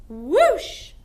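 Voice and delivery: loud rising whoosh; ghostly whoosh. Shown as a demonstration. Teacher shows the class a ghost swooping. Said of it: "Ghosts swoop".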